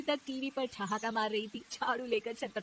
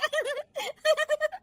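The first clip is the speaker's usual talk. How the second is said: high, giggling peals; loud laughter; 6 dB louder than usual. Acted. Daughter-in-law amused by the TV game, laughing loudly. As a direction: keep laughing, highly amused. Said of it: "बहू के हंसने की आवाज".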